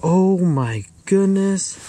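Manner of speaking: high, slow exclamation; amazed. Real, not acted. Angler looking at the very tall bluegill, amazed. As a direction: stretch it out in astonishment. Marvelling at measured fish.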